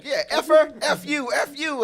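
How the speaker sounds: teen cursing into headset, mock yell; imitating son yelling. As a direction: shout it like a kid raging on headset. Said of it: "He just going off".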